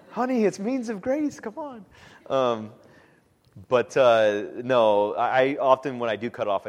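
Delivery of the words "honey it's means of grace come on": pleading husband. Retold or imitated. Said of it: "answering wife about bedtime".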